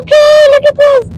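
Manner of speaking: very high, loud squeal; acted amused reaction. Wife reacting to daughter's fierce post, squealing.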